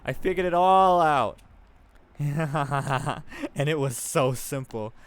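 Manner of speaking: cracked triumph, laughing at the truth; manic laugh. Acted. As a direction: Nellie unhinged, gloating at her discovery. Nellie laughs, figures it out.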